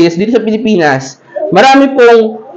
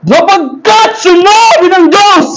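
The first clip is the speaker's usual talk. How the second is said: high, slow booming preacher proclamation; mock fiery preacher; 9 semitones higher, slower than usual. Mocking other churches' preachers with a high, slow booming cry. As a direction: ham up a thundering church preacher. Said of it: "mga iglesia".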